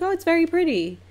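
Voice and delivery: sweet, high social voice; polite admiration. Retold or imitated. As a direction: praise it politely, pitch way up. Polite praise, retold.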